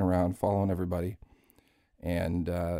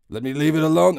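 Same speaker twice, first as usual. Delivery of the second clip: Scrooge; 7 semitones higher, 8 dB louder than usual. Acted. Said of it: "one-man Christmas Carol show".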